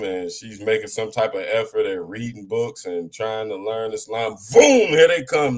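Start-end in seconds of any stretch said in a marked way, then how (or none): 4.5-5.6 much higher, louder, slower burst on boom; loud mock alarm. Mocking brothers swooping on sisters, loud and high. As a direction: announce the swoop like a siren.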